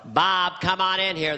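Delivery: slow, drawn-out presidential summons; exaggerated folksy president; George Bush impression. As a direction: call an aide in a mock presidential voice. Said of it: "do your impression of me".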